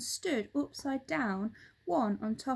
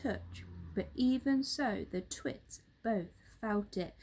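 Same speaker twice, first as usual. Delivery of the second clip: ordinary delivery, nothing marked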